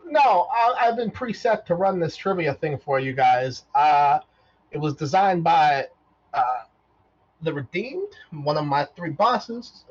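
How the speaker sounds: high robot explaining; a chirpy robot reciting its purpose. Acted. Robot answering whether he'll kill them, high.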